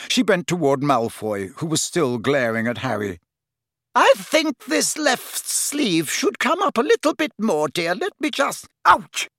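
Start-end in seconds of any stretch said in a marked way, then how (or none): 3.9-8.7 high, fussing seamstress pretending all is fine; busy herself sweetly with the sleeve; fussy shopkeeper witch. A narrator's reading. Madame Malkin fussing. 8.9-9.3 high, loud yelp of pain; bellowing. A narrator's reading. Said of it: "bellowed Malfoy".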